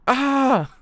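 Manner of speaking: high, loud yelp; sudden excited shriek; loud outcry. Real, not acted. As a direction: cry out in reaction. Outburst while reading manga.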